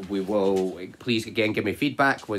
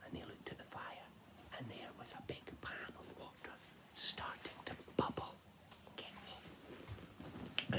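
fast hushed whisper; tense storytelling whisper; whispered narration; 2 semitones higher, 21 dB quieter than usual. A narrator's reading. Narrator building suspense for kids, whispered.

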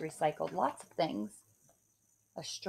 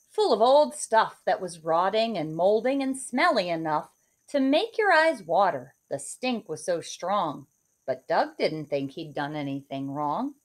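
put-on lively rhyming read-aloud for kids; story narration; 3 semitones higher, 6 dB louder than usual. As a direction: read it like a bedtime picture book. Reads picture book aloud.

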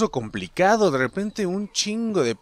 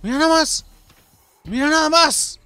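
exclaimed high and loud; mock reproach; 12 semitones higher, 4 dB louder than usual. Player showing enemy his fallen friend, mock reproach. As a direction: raise it high, scolding in play. Scolds enemy after gunfight.

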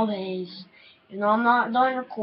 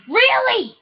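indignant disbelief; 12 semitones higher, 5 dB louder than usual. Real, not acted. Higher second take.

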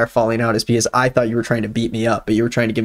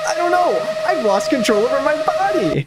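high-pitched hammed-up distress; mock panic; 11 semitones higher than usual. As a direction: wail it high in fake alarm. Characters lose control of bodies.

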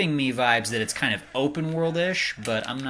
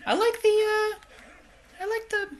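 much higher squeaky voice; squeaky laughing voice. Real, not acted.

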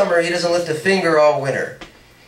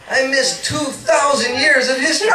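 eager insistence; 8 semitones higher than usual. Acted. Paul insists, raised and laughing.

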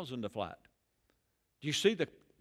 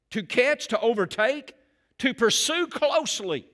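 rising preacher's call, much louder and higher; preaching; 6 semitones higher, 6 dB louder, slower than usual. Acted. Pastor preaching to the congregation about marriage, building fervor. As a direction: build the list to a preaching climax.

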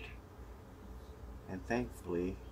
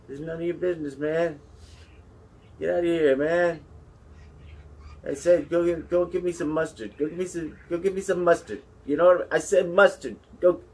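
high, loud, hammed-up fury; comic angry rant; 9 semitones higher, 9 dB louder, faster than usual. Commentator ranting at a nosy mouse, hammed-up yelling. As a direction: bellow it like a fed-up boss barking orders.

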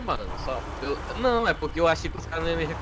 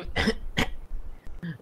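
cough; 4 semitones higher, 4 dB quieter than usual. Acted. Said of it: "meu pulmão tá meio ruim".

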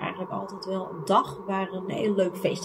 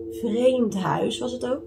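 ordinary talk

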